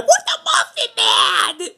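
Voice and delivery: very high, drawn-out falsetto squeal; hammed-up shrieked question. Retold Muffin Man exchange, hammed-up falsetto shriek. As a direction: shriek it slowly in a mock-frantic squeak. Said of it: "And he goes".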